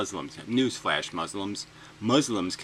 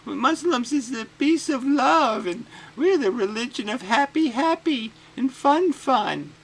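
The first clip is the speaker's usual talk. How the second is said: much higher, slow, far-off mock voice; mocking spokesman; 14 semitones higher, 5 dB louder than usual. Mocking the advocacy group's press conference in a high silly voice. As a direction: ham up a simpering spokesman. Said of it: "and they went".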